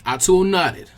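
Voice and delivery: ordinary delivery, nothing marked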